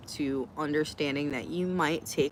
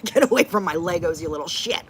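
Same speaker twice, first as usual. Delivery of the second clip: louder mock-angry warning; mock yell at kids; 7 dB louder than usual. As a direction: shoo the kids off the Legos, half-joking. Acting out yelling at nieces.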